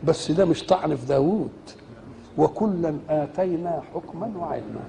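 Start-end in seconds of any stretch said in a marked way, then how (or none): 2.4-4.8 slow, soft chanted recitation; Quran recitation. Acted. Preacher recites the verse to listeners in slow chant. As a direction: recite the verse slowly and softly.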